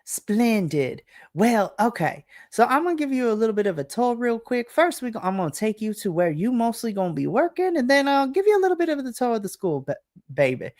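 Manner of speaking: a syrupy Southern welcome, honey and darling; Southern lady. Acted. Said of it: "This is very Southern, like, lady".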